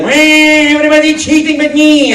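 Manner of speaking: high, loud whiny villain; Waluigi voice. Retold or imitated. Waluigi at the Mario Kart session, a high loud whiny voice. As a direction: complain like a sore loser. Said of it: "He's like".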